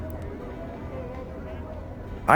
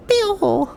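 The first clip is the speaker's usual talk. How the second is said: high chirp. Acted. Imitating pet's chirp.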